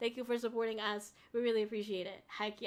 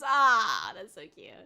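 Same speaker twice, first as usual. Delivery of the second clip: ordinary delivery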